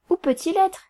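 high little-miss voice, lifting at the end; little Miss Bas-Bleu. A narrator's reading. Little miss asking after the new celebrity, high questioning voice. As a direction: pitch it up like a small society miss. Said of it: "dit la petite Miss Bas-Bleu".

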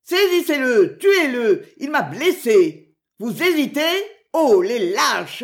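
loud, high shout, stretching the words. A narrator's reading. Wounded baron orders soldiers to seize the archer, shouting. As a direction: shout furious orders at hesitating men. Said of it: "mais continuant à crier".

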